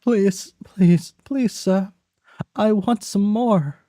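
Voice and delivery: put-on high, pleading child voice; Oliver Twist attempt. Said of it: "I can't do it".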